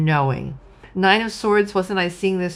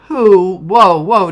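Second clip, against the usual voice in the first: Spirit voice; 10 dB louder, slower than usual. Retold or imitated. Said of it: "And Spirit's like".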